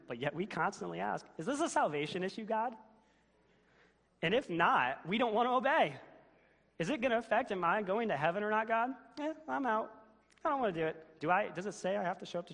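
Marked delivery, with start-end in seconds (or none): 1.4-2.8 high, loud mock question to God; mock believer. Pastor mocks believers asking God about salvation, high and loud. 4.2-6.0 loud, high rebuke, building to obey; preaching loud. Real, not acted. Pastor rebukes the congregation in a loud, high preaching voice. 6.8-11.2 high, loud whiny mock excuse; mock believer. Pastor mocks a believer who quits on God, high, loud and whiny.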